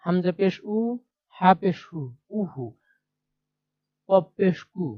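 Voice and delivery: letter, পেশ, then its u sound: উ, হু, কু; spelling demonstration. Shown as a demonstration. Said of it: "এভাবে যতগুলো আছে ততগুলো আপনাদের".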